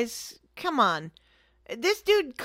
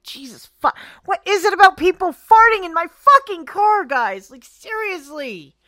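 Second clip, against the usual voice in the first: exasperated rant; 7 semitones higher, 11 dB louder than usual. Real, not acted. Venting to her co-host about farting passengers, exasperated yell. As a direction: blow up at the listeners, then sag.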